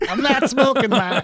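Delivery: very high-pitched, laughing Boston impression; Boston accent. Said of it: "the Dunkin' Donuts".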